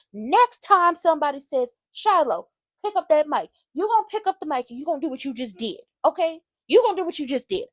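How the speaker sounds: loud, high scolding; lecturing Shiloh about seizing the mic, loud and stern; stern lecture. Real, not acted. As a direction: lay down the law to him.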